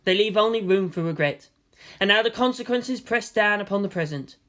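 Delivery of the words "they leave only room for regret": plain reading voice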